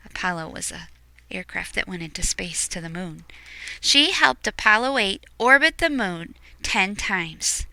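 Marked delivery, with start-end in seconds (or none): none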